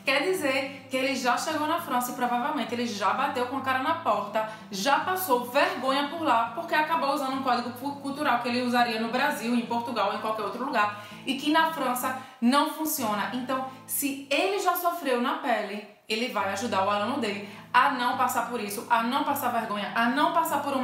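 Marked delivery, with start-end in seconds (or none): none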